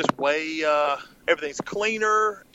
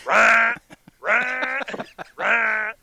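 loud mock fart sounds, rah rah rah; comic fart-sound mimicry; fart noise imitation; 5 semitones higher, 3 dB louder, much slower than usual. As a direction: blurt out the fart noises with a laugh.

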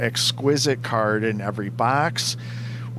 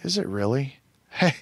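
ordinary talk

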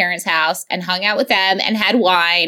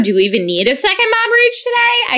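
mock high excitement; 12 semitones higher than usual. Playful segment tease.

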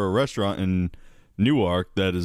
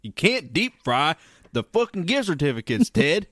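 higher put-on Southern accent scolding; a Southern good ol' boy telling off his brother; 6 semitones higher than usual. Timothy telling brother Ted off about the fryer, Southern accent. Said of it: "why we're doing Southern accents".

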